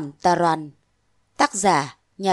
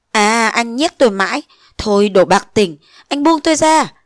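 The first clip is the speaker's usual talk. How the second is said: louder, rising to a cry on let go; angry protest; 2 semitones higher, 4 dB louder than usual. Acted. Nguyệt pulling free from Phong, angry cry. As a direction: shake him off in anger. She pulls away from him.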